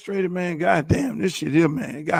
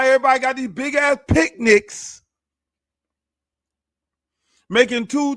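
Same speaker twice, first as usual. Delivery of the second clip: ordinary delivery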